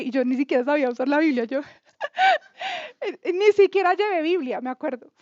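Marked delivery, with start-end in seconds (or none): 2.6-3.3 short high laugh at herself; high burst of laughter. Real, not acted. Woman laughing at her younger self, high burst.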